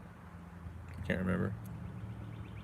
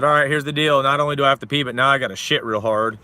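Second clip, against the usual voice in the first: loud demand; 3 semitones higher, 6 dB louder than usual. Retold or imitated. Threatening the cops to get out, loud ultimatum. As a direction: lay down the ultimatum loudly.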